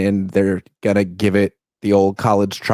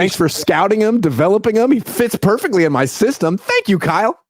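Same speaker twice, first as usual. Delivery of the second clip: smug mock thanks; 8 semitones higher than usual. Voicing McVay thanking Kyle.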